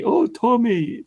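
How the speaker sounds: high, whiny mock supporter voice; mocking gullible fans. Streamer mocking Tommy's fans in a high whiny voice. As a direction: sneer it out like a doting fan. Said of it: "and then people say".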